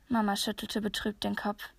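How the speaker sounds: ordinary delivery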